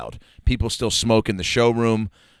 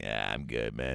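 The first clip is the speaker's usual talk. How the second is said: much lower, softer deadpan; bored guest; 13 semitones lower, 15 dB quieter than usual. Retold or imitated. Mocking a dull podcast guest, low deadpan mumble. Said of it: "if I was like".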